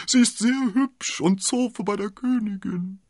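high-pitched weepy landlord; hammed-up tearful lament; whiny, tearful. A narrator's reading. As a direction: whimper it high and loud. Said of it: "mit weinerlicher Stimme".

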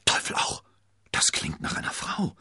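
urgent stage whisper; hushed, voiceless exclamation. A narrator's reading. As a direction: whisper it in alarm. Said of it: "flüsterte er".

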